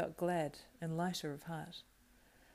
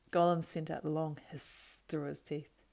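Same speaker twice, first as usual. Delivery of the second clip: usual speaking voice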